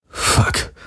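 a voiceless breathed curse. A narrator's reading. Garland waking from the nightmare, breathing a curse. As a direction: let it out on a shaky breath. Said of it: "hauchte er".

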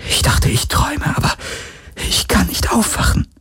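frightened, high whisper; shaken, whispery female character. Acted. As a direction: whisper it as if still stuck in a nightmare. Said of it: "flüsterte sie".

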